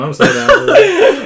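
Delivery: high, slow giggling agreement; laughing, drawn out high. Real, not acted. Laughing hard while agreeing with friends about a recap show. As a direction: stretch it slowly through real laughter.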